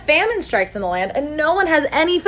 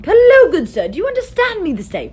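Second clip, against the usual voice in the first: put-on higher, louder, formal old-fashioned greeting; old-timey gentleman; 7 semitones higher, 4 dB louder than usual. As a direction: play a stuffy olden-days gent. Old-timey speakers of one language.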